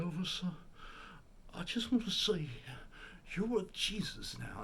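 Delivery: high-pitched woman imitating the King. Religious woman addresses the late singer, female Elvis impersonation. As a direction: pitch it up as a devout lady tribute act. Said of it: "She's almost doing a female in-, Elvis impersonation".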